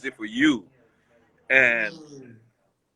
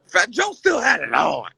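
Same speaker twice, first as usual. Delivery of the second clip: imitating Bleak; 6 semitones higher than usual. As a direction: mimic Bleak in disbelief. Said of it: "He was like".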